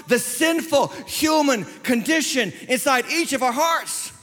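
impassioned, high-pitched declaration; emphatic preaching. Real, not acted. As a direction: preach each word with weight. Preacher delivers the repeated answer.

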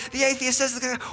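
ordinary talk, nothing marked

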